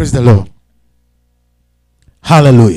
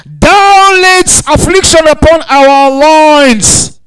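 preached scripture; 9 semitones higher, 7 dB louder, much slower than usual. Acted.

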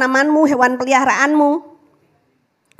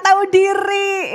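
shout; 6 semitones higher, slower than usual. Shown as a demonstration. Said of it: "Ketikkan di kolom komentar".

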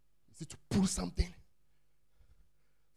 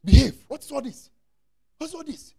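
exasperated Peter; 10 dB louder than usual. Retold or imitated. Peter scolding Jesus about the crowd, exasperated. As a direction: scold like a fed-up disciple. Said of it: "He said".

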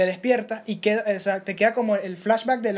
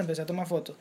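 "empecé a tomar fotos" said softly and trailing off; fading repetition; 4 semitones lower, 7 dB quieter than usual. Retold or imitated. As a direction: let the repeated "fotos" die away. Relato repetitivo que se apaga. ("empecé a tomar fotos" dicho más bajo, apagándose)

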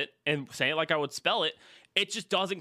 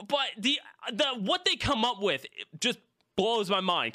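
ordinary talk, nothing marked

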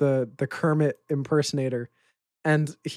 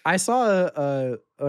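usual speaking voice

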